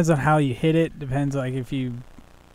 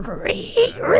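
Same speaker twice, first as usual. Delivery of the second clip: imitated mice squeal. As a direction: squeal like little mice, pitched up. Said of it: "they started squealing".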